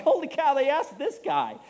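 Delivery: high, loud burst of disbelief; mock astonishment. Preacher retelling his surprise at being asked, loud and high. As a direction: exclaim it like you can't believe they picked you. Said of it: "I'm like".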